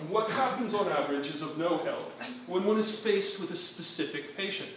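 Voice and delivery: a lightly put-on British accent for a medical president. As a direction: quote him in a hint of British accent. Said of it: "I'm gonna try to do the accent".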